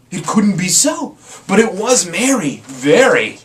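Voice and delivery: loud, rising disbelief; astonished exclaim. A narrator's reading. Narrator marvelling at the merry singing, loud and amazed. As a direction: build it up into wonder.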